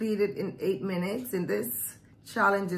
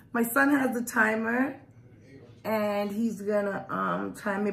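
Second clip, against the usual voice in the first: usual speaking voice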